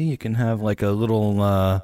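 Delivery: ordinary delivery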